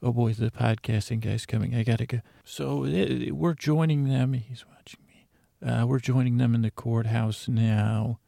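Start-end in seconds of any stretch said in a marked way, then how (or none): 4.3-5.3 hushed whisper so he won't hear; secretive whisper while being watched. Real, not acted. Hiding from the podcasting guy.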